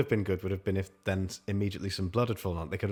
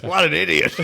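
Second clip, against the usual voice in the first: laughing mock sneer; 6 semitones higher, 9 dB louder than usual. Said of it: "Landlord swims past and goes".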